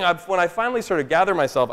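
ordinary delivery

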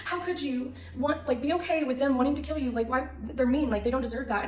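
high, loud, pleading questions; distraught disbelief. Retold or imitated. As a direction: re-enact protesting in anguish. Retold distraught questioning of boxer.

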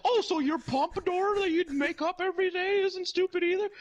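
indignant high, loud clown; Ronald McDonald. Retold or imitated. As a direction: fire back in hammed-up falsetto outrage. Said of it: "And he's like".

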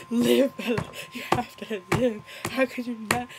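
mock dramatic plea. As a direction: plead as in an overwrought film scene. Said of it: "trying to make it dramatic".

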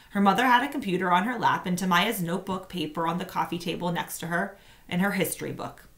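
plain reading voice

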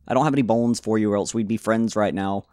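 normal talking voice

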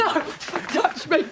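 slow, sobbing refusal; tearful recoil. Acted. Woman refusing a man's touch, crying. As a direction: push him away through sobs.

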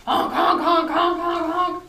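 loud, high-pitched rapid honks; goose honking. A narrator's reading. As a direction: honk like a whole noisy flock. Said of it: "All the geese were honking".

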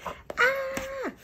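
high delighted squeal; excited squeal. Real, not acted. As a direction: squeal with joy at the reveal. Unveiling new planner.

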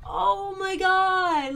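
high, loud, drawn-out exasperation; retold cry of a parent at wits' end; exasperated. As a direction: stretch it out, loud and fed up. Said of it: "I was so mad".

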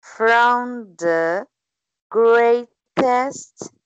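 careful word-by-word reading aloud; slow word by word. Shown as a demonstration. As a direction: read slowly, one word at a time. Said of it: "cobrindo as palavras devagarzinho".

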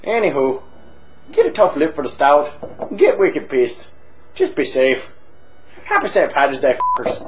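boozy Irish pub regular, chatty and cheeky; hammed-up Irish drunk telling a tale; stage Irish accent. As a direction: lay on a thick comic Irish brogue. Held Irish voice.

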